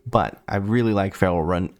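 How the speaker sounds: normal talking voice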